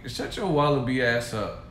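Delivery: usual speaking voice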